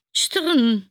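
loud, high rattling clank; clanking noise. Acted. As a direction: mimic a metal rattle. Rattling noise imitated again.

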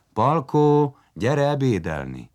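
high, loud call on the name, then easing; mother calling. A narrator's reading. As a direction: call a child in to lunch. Mother calls boy to lunch. (anyai, hívogató kiáltás)

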